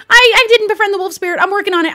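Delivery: fast, high and loud babble; flustered, rushed excuses. Acted. Flustered player defending herself to the spirit, fast and loud.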